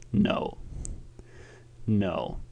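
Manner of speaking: terse throaty cowboy negative; croaky Western tough guy. Acted. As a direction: croak it quietly in the throat, like a bearded ranger. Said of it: "croaking in your throat".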